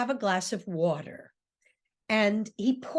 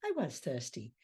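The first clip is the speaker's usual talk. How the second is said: soft satisfied; 8 semitones lower, 10 dB quieter than usual. Retold or imitated. Ruth's satisfied closing line.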